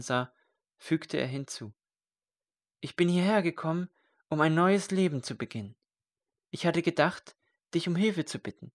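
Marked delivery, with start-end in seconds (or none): 2.8-8.7 higher and fast, words tumbling out; eager rush of words; eager outpouring. Acted. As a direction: blurt out the fresh start. Eager confession of new life.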